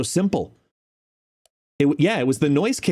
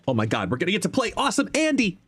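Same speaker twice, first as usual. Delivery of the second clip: fast, higher-pitched excitement; excited gushing; 8 semitones higher, faster than usual. Real, not acted. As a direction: gush in a quick, high rush. Thrilled about coming game.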